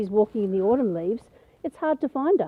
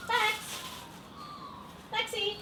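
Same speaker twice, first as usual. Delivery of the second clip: high call for the dog; 13 semitones higher, 11 dB quieter, slower than usual. Real, not acted. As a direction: call the dog across the garden. Calling for the dog.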